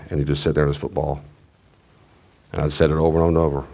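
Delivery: ordinary talk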